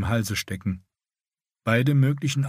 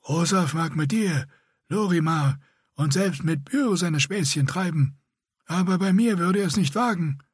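higher, grating voice like a rasp; rasping scarred warrior; 8 semitones higher than usual. A narrator's reading. Scarred Bretan rebuffing the older man, higher rasping voice. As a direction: scrape each word out like a rasp. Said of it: "Ihr Raspeln war eine Beleidigung für das Ohr".

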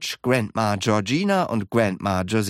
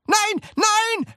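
very high, desperate cry; shouting. A narrator's reading. Wonka struggling to get free, shouting. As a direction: cry out in high-pitched panic. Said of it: "schrie Mister Wonka".

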